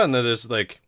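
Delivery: ordinary talk, nothing marked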